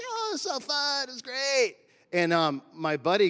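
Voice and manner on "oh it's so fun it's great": much higher, slow, breezy voice; sing-song the easy confidence; carefree past self. Retold or imitated. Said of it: "I'm like".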